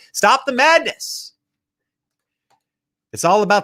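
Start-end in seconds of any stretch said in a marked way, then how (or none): 0.1-1.3 higher and slower, "stop the madness"; high, firm stop; sharp interruption. Real, not acted. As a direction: snap them out of it. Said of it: "cuts off the whiner".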